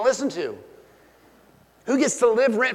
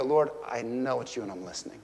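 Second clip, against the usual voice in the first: low, soft reply to God; hushed prayer; 8 semitones lower, 8 dB quieter, faster than usual. Acted. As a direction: answer the Lord quietly and humbly. Acts out answering God in prayer.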